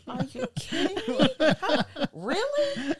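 high, laughing disbelief; wife mocking people's rude question, incredulous and laughing. Incredulous reaction to rude comment.

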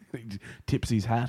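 normal talking voice

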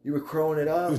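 high mocking tease; teasing high voice.

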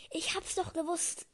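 excited character line. A narrator's reading. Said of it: "rief Stan aufgeregt".

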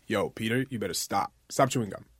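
normal talking voice

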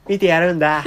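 loud, bright commercial catchphrase; Fuwa-chan ad voice. Acted. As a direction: punch it like a TV ad tagline. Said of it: "フワちゃんのGoogleピクセルのシーエムみたいに".

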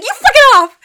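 high, loud mock-hysterical retort; freaking out. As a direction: imitate panicked squealing through laughter. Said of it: "was just freaking out".

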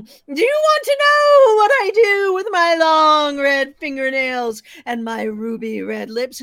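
shrieking ghost. Acted. Ghost chasing the fleeing man, high shrieking wail.

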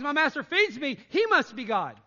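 loud, eager dog thinking aloud; loud mock dog voice; excited dog voice. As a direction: blurt it like a happy dog. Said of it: "A dog thinks".

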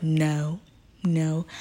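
soft, subdued mock denial; meek denial. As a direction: play him quietly denying it. Joe's meek denial, acted.

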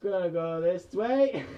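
loud, high and slow announcement; loud drawn-out call. Real, not acted. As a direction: stretch it out and call it loudly. Calling out a move in game.

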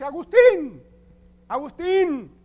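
high, loud call across the street. Retold or imitated. Prostitute calling to Agustín in the street, high loud call. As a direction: shout his name to catch him.

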